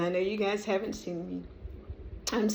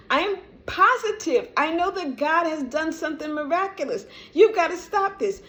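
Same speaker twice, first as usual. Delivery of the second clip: raised, insistent talking to her own mind; firmly commanding her body to calm down; firm self-talk; 6 semitones higher than usual. Retold or imitated. Herself ordering her subconscious mind, firm raised self-talk. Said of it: "And I go".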